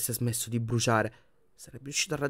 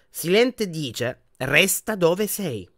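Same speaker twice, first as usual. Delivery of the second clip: very slow, higher, spelled-out relay; condescending; 6 semitones higher, 5 dB louder, much slower than usual. A narrator's reading. Portrait repeating Dumbledore's message to Harry, condescending. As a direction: stretch each word as for a dimwit.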